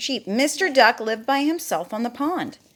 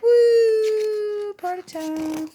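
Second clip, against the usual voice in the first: high, long whoop that fades away; party-whoop pig; 12 semitones higher, 4 dB louder, much slower than usual. Acted. Pig partying in the pond, high carefree whoop. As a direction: whoop like a reveller, then tail off high. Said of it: "Pig's just like".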